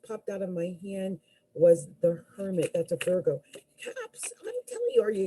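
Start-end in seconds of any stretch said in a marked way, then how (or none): 3.8-5.3 much higher, urgent and keyed up; excited high-pitched insistence; excited urgency. Real, not acted. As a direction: press the point, suddenly worked up. Urgent aside to Capricorn viewers.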